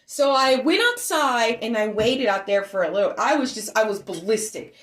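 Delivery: usual speaking voice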